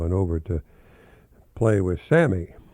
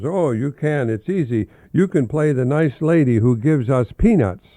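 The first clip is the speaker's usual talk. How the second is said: put-on louder, higher, cheerful little boy; eager child; 5 semitones higher, 6 dB louder than usual. Marvin tells his mother how to play, loud and eager. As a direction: cheerfully explain the game to mom.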